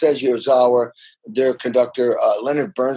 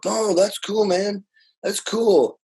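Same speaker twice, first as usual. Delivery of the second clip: higher, lightly apologetic shrug of a reply; 8 semitones higher, slower than usual. Retold or imitated. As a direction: imitate him brushing it off, a touch sheepish. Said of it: "[name] was kind of apologetic".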